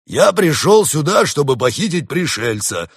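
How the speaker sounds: loud, high-pitched growling bear; big bellowed bear reply; roaring bear. A narrator's reading. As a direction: roar it back as an angry bear. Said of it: "прорычал в ответ медведь".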